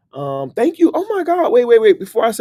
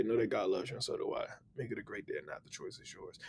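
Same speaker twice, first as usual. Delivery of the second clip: fading sign-off; 6 semitones lower, 18 dB quieter than usual. Real, not acted. Blessing his viewers goodbye, fading softly. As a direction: voice dropping softer and softer.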